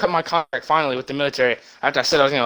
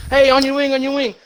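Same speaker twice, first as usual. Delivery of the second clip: high, urgent call to a wingman; pilot shouting warning; 9 semitones higher than usual. Retold or imitated. Fighter pilot shouting to the other jet, high and urgent. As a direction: yell it up high, alarmed.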